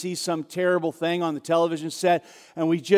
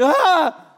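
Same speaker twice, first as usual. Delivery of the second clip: loud, high startled "Ah!"; startled scream; 10 semitones higher, 5 dB louder than usual. Acted. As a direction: yelp as if the brakes were slammed. Passenger jolted by sudden braking.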